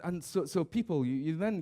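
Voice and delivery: ordinary talk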